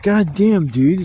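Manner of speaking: loud, high exasperated cry; outraged. Retold or imitated. Complaining to the dog owner, loud outrage. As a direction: vent disbelief loudly. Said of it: "It's like".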